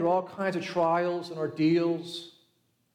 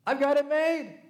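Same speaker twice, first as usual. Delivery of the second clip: gleeful boast; 7 semitones higher than usual. Retold or imitated. Farmer gloating over his harvest.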